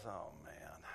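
low, hushed groan of regret; quiet, deflated acted-out dismay. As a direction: say it under your breath, pitch sinking. Just ran over parents' dog.

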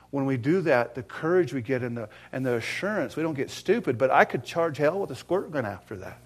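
usual speaking voice

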